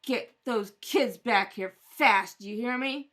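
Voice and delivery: bang each threat home; loud furious threats of a lawsuit; angry threat. Acted. Threatens to sue the city.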